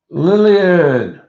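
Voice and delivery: excited call. Real, not acted. Announcing the giveaway winner.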